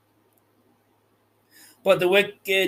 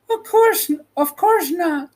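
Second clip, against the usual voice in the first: flustered answer; 12 semitones higher than usual. Acted. Dorothy answering, frightened.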